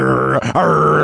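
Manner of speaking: a light pirate growl, arr drawn out; pirate impersonation. Acted. Dog cop playing a pirate for the officer, light growl. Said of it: "show you my pirate impersonation".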